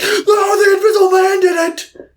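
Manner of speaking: high frantic yell, mocking her; frantic shout. Cecilia in the ward, shrieking mock accusation. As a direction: scream it like nobody believes you. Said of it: "she's like".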